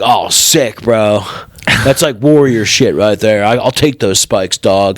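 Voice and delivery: low-pitched dude voice; mock bro. Bro accepting his spike pit sentence, low mock bro voice. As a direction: take it gladly as a low-voiced dim bro.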